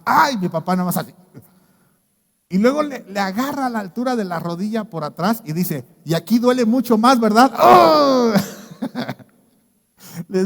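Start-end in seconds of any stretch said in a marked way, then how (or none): 0.0-0.4 a high, loud mock "ay" of pain; pained cry. Father cries out when the doctor presses his knee, mock pained cry. 6.0-7.5 a louder, higher mock doctor's taunt; teasing doctor. Doctor presses the father's knee and teases him, loud mock taunt. 7.6-8.3 a high, loud hammed-up howl of pain. Father howls as the doctor grips his knee, hammed-up howl.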